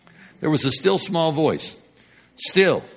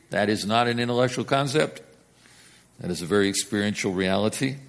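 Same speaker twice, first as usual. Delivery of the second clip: ordinary talk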